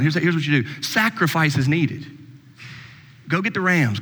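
usual speaking voice

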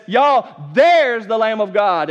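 loud, slow proclamation; proclaiming. Acted. John pointing the crowd to Jesus, loud slow call. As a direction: call it out to everyone.

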